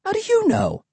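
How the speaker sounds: very high, shrill challenge; shrill question. A narrator's reading. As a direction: fire it back, very high.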